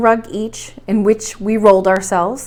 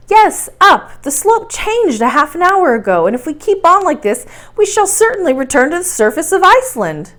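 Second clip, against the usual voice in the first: higher and louder, pressing the point; insistent correction; 6 semitones higher, 4 dB louder than usual. Acted. Axel insisting to his uncle that the path goes up, raised and pressing. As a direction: hammer home that they're climbing.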